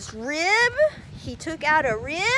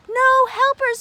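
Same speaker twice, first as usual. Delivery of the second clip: No helpers, higher and louder; 8 semitones higher, 5 dB louder than usual. Shown as a demonstration. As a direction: lift No helpers up high.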